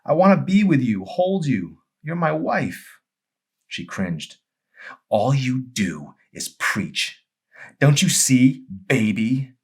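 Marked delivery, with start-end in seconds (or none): 0.0-3.0 pleading husband. Acted. Joel pleading with his wife. 5.1-9.5 bitter wife. Acted. Amy attacking Joel.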